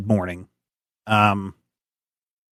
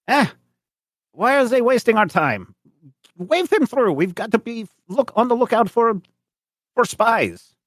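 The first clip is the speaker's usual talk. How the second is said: officious German officer; 13 semitones higher than usual. Acted. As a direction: report the alerts like a pompous checkpoint officer. Officer waves them through.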